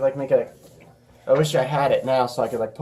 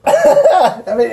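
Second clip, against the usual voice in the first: excited shout; 10 dB louder, much slower than usual. Real, not acted. Loud excited call.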